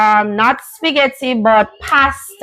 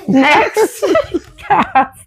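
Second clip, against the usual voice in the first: laughing; 6 semitones higher, much slower than usual. Real, not acted. Laughing while reading the list.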